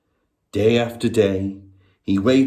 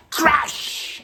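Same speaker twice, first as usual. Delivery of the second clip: crash. Shown as a demonstration. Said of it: "we're all gonna say crash".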